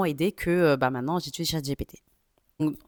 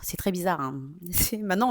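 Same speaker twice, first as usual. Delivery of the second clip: usual speaking voice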